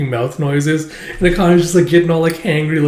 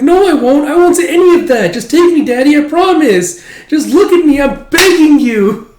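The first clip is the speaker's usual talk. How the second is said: put-on high, loud pleading little boy; whiny child voice; 13 semitones higher, 8 dB louder than usual. Son begging his father to take him to the festival, high whiny child. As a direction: beg like a small kid tugging at dad.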